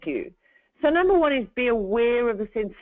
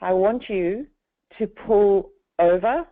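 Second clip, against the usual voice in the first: slowed calming voice. Shown as a demonstration. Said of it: "the way that I'm talking to you right now is very slowed".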